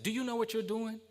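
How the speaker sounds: high, insistent challenge; imitated old man's high-pitched challenge; stern old man. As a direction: demand it like a stern elder confronting someone. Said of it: "with this very stern look on his face".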